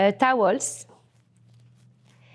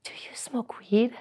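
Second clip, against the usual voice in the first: hushed, conspiratorial ask; a soft, tempting whisper; whispered question; 7 dB quieter than usual. Retold or imitated. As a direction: whisper the offer like a secret. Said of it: "he says the ultimate sentence".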